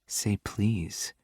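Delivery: soft, sultry and slow. A narrator's reading. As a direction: purr it like honey. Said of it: "Her sultry voice is honey".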